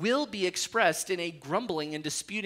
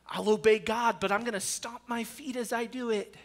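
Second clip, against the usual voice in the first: mock sulky voice, higher; grudging obedience; 5 semitones higher than usual. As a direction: play a believer obeying with a pout. Imitating a grudging believer.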